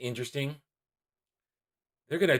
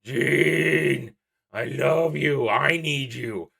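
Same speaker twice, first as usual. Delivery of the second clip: hammed-up lovesick pining, drawn-out words; Wolverine meme, pining; much slower than usual. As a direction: moan the beloved's name in mock longing. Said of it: "famous meme of Wolverine sitting there holding Jean Grey's picture, like".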